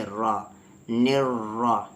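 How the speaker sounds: نر را with a thick, rolled, doubled R; heavy R. Shown as a demonstration. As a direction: make both R sounds in نر را heavy. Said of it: "تب بھی heavy پڑھیں گے". (نر را میں را موٹی اور بھاری)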